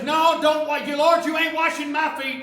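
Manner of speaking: loud, high flustered refusal; won't let the Lord stoop; indignant refusal. Acted. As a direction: Peter loudly refusing Jesus. Peter refuses foot washing.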